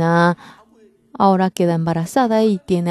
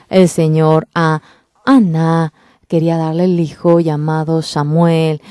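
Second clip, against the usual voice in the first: ordinary talk, nothing marked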